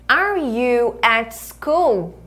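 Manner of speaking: very slow, drawn-out teacher demo; slow-motion classroom question; model for repeating. Shown as a demonstration. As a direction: stretch each word for learners to copy.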